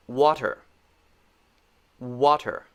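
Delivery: "Water" with lips catching the W. Shown as a demonstration. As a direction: round lips before "Water". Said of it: "quick examples of the W sound".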